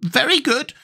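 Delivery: very high squeaky creature; high-pitched squeaky character; Gump's squeaky voice. A narrator's reading. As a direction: squeak it out, far above your own voice. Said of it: "said the thing".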